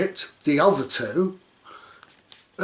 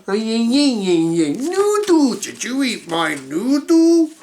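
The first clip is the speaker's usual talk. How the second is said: put-on high, loud babyish voice; silly voice; 6 semitones higher, 5 dB louder, much slower than usual. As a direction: play a whiny little kid. Silly childish noodle voice.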